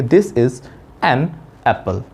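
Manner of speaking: "an" as full "ann", pause, then "apple"; "an" unreduced and unlinked from "apple"; separated words. Shown as a demonstration. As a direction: say "an" and "apple" apart, each word distinct. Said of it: "एन और एप्पल इसको अलग-अलग बोलना है".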